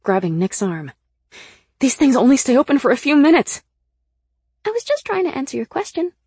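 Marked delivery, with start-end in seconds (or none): 1.8-3.6 high, very loud protest; raised, panicky shout of warning; urgent yell. A narrator's reading. Same character still yelling urgently. 4.6-6.1 very high character voice; high, put-upon little voice; defensive protest. A narrator's reading. Sybil protests after being yelled at.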